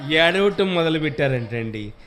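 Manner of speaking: ordinary talk, nothing marked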